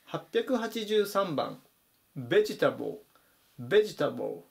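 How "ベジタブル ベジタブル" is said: VEG-etable, first syllable punched; vegetable stressed on first. Shown as a demonstration. Teacher modelling a word for learners, first syllable of vegetable stressed. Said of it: "最初を強く読みます".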